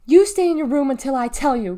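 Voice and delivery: loud, stern order; shouting. Acted. Mother shouts at Deli to stay in her room, shouting. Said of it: "Clarice shouted at her".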